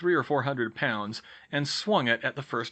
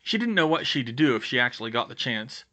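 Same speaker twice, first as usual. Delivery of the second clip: ordinary delivery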